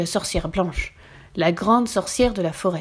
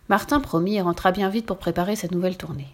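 plain reading voice

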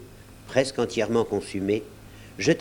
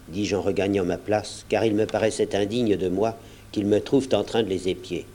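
ordinary delivery, nothing marked

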